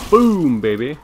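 loud, high victory yell; triumphant shout. Real, not acted. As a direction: cheer the hit.

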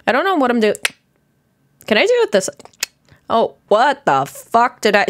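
ordinary talk, nothing marked

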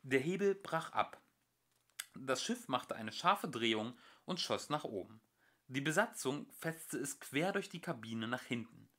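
ordinary delivery

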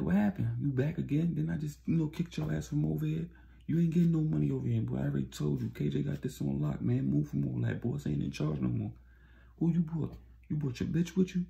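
high, mocking, aggressive taunts; high-pitched retold taunting; hostile street thug. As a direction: sneer at them like you run the block. Rival dealer mocking Sting and Tony.

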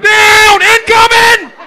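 high, very loud battlefield shout; shell-shock yell. Acted. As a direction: scream the warning like bombs are falling. Shell-shocked soldier ducks fire.

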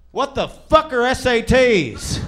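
a clueless guy who has never heard of the SATs; baffled stranger. Retold or imitated. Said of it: "the guy went".

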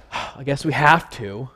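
sigh, then a low-energy mock-reluctant admission; grudging resignation. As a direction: say it like a mock chore you can't escape. Acted reluctant excuse, with sigh.

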